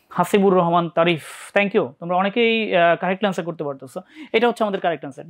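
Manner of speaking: normal talking voice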